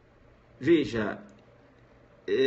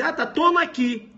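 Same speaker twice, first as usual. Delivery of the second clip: higher, louder, trusting and eager; naive victim; 10 semitones higher, 5 dB louder than usual. Acted. Homeowner trusting the fake cable technician, eager and higher. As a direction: hand it over without a second thought.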